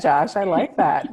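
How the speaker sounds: ordinary talk, nothing marked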